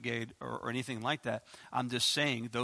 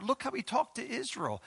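normal talking voice

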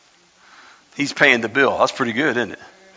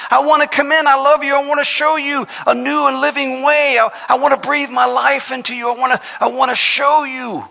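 hollering plea; 9 semitones higher than usual. Acted. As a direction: call through the door with longing. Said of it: "he's hollering".

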